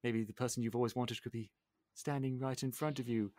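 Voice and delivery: soft, low confession; acted wistful hint, hushed; shy hint. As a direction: murmur it like a secret crush. LeFou hinting love to Gaston.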